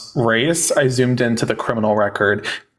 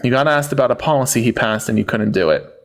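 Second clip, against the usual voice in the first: normal talking voice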